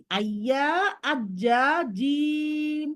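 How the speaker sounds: very slow drill, আইয়া আজ্জা জিম stretched out; a slow, careful pronunciation drill; practice drill. Shown as a demonstration. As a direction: drag each syllable to train জিম. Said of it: "তোমরাও এরকম practice করবে".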